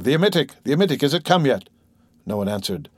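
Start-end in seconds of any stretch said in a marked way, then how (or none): 0.0-1.7 higher-pitched, mildly frantic demand; understated alarm, pitch lifted; urgent exclamation. A narrator's reading. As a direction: call out anxiously for the medicine.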